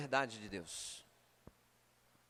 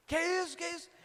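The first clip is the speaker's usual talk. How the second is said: startled; 10 semitones higher, much faster than usual. Retold or imitated. Husband startled by wife's scream.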